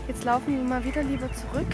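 usual speaking voice

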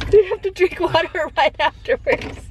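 high voice breaking into laughter; laughing while talking. Real, not acted. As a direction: talk through the giggles. Laughing while talking about sour candy.

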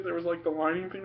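usual speaking voice

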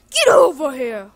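lightly voiced Scorpion shout; Scorpion catchphrase. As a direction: bark the fighter's catchphrase. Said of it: "I'm Scorpion".

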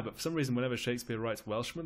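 usual speaking voice

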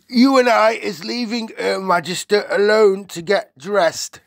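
high, loud giant voice; considerate giant. Acted. BFG tells Sophie to leave, loud giant voice.

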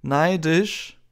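high, incredulous echo; drawn-out rising sneer; scornful Malfoy. A narrator's reading. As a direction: throw the word back with disdain. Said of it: "der jetzt nicht mehr zu schreien brauchte".

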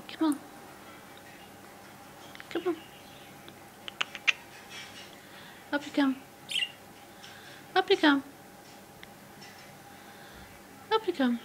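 high, sing-song coaxing to a bird; pet talk voice. Real, not acted. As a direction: coax a shy budgie out. Coaxing bird onto finger.

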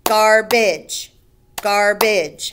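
"Garbage" in two strong beats, gar, bage; split into syllables. Shown as a demonstration. Teacher counting two syllables, "Garbage" beat by beat. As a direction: punch each half of "Garbage" separately. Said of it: "has two".

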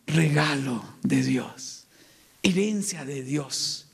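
very low, slow and weighty; solemn affirmation. Shown as a demonstration. Preacher declares what children are, solemn affirmation. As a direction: drop deep, drawing out each answer. Solemn answer to own repeated question.

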